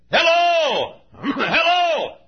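high, loud drawn-out holler; hollering hello. Retold or imitated. Preacher calling at a country church with no doorbell, high hollered hello. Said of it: "hollering hello".

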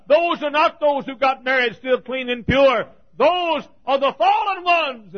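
loud and high, rushing, then a ringing question; preaching at full force. Acted. Preacher to his congregation, asking about the ringed men, preaching loudly. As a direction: cry it out over the crowd.

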